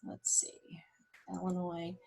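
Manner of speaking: soft whisper to herself while searching; whispered aside. Real, not acted. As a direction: murmur it under your breath. Muttering to self over map.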